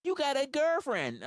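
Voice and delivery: high mocking voice of people telling him to calm down; mocking critics. To viewers, mimicking people who tell him to calm down, high mock voice. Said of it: "people to this day tell me".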